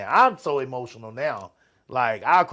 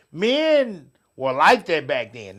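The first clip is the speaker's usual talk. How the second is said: ordinary talk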